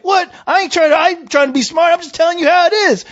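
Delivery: high-pitched, defensive retold protest; indignant comeback. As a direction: talk back hotly, pitch way up. Retold argument after cop's threat.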